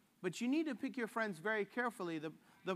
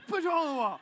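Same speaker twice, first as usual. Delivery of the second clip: louder, higher mock pep shout; fired-up Joe; 6 semitones higher, 7 dB louder, much faster than usual. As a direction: pump him up like a coach. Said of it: "Joe's like".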